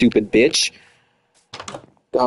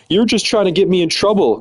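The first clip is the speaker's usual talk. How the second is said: mocking ex; 7 semitones higher than usual. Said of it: "this bitch told me".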